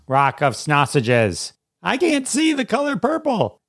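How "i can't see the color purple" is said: very high, put-on joke voice; high-pitched comic aside. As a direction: squeak it out as a gag. Acts out a joke title.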